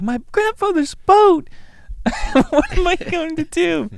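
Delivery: high, loud, slow wail; mock despair. Will Forte mourning grandfather's boat, high mock wail. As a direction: wail over the wrecked boat. Distraught over wrecked boat.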